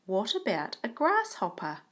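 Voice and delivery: storybook question with "grasshopper" rising up; rising question tune. Shown as a demonstration. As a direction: ask "What about a grasshopper?" so it climbs at the end. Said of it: "our voice goes up at the end".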